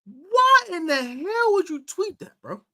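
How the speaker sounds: high, slow, drawn-out disbelief; incredulous scolding. Real, not acted. Host scolding a player about his tweet, incredulous. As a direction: stretch the why like you can't believe it.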